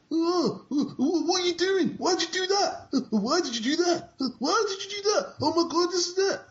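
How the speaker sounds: parodying anime spectators, high and whiny; mock moaning. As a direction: whine it like a melodramatic onlooker. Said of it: "They're always moaning about".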